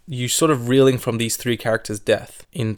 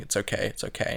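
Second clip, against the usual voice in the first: much softer hush, soothing; soft shushing; 2 semitones lower, 10 dB quieter than usual. Retold or imitated. Iron Man soothing the dying boy, softly hushed. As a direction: hush him gently. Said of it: "Iron Man is shushing him".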